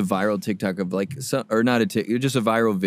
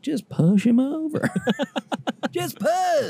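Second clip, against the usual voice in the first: egging on; slower than usual. Retold or imitated. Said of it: "I'm like".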